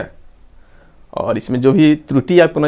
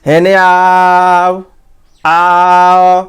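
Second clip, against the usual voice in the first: drawn-out high call across a distance; 4 semitones higher, 4 dB louder, much slower than usual. Retold or imitated. Teacher imitating a villager calling someone over. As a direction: stretch the vowel like hollering to someone far off. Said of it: "लोग चिल्लाते हैं".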